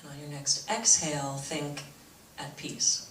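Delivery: soft, low meditation voice with long pauses; guided meditation. Real, not acted. Teacher leads audience through breathing meditation, soft guided-meditation voice. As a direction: guide them gently into calm, hushed.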